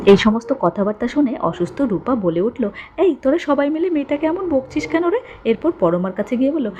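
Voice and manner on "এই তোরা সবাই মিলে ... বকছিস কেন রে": high-pitched voiced protest; protesting Rupa. A narrator's reading. Ailing Rupa tells the family off for scolding, high-pitched protest. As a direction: pitch it up and scold them all.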